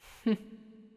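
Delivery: brief, amused demon chuckle; short chuckle. Acted. Demon amused by a wary listener, short chuckle. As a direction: chuckle briefly at their distrust.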